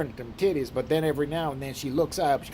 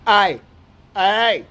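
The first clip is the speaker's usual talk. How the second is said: very loud, higher mimicked greeting; brother's greeting; 7 semitones higher, 10 dB louder, much slower than usual. Brother starting every phone call, bellowed greeting. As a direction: blast it out, imitating a country trucker. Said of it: "He never says hello".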